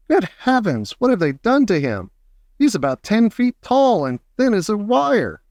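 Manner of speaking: high startled exclamation; shocked old man. Acted. Grandpa Joe gasping at Mike TV, high shocked cry. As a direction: gasp out in alarm, pitch up high.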